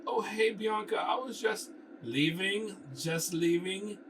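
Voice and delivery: flustered character. Acted. Flustered character caught flirting.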